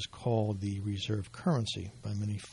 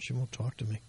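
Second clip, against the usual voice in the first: ordinary talk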